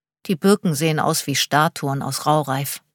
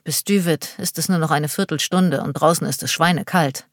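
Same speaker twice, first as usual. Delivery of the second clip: usual speaking voice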